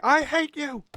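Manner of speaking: put-on high, loud shrieked child's cry; screaming kid. Streamer imitating the film's kid, in a high loud shriek. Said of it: "the kid is definitely saying".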